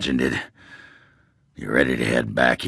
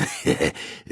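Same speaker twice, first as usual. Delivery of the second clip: breathless snicker; 6 dB quieter than usual. Acted. Dwarf snickers at own joke.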